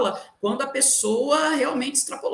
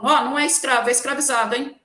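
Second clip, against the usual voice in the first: loud, pointed mock correction; louder, insistent telling-off; curt correction; 3 semitones higher, 5 dB louder, faster than usual. Shown as a demonstration. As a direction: demonstrate a scolding teacher correcting a pupil. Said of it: "não pode simplesmente falar para o aluno".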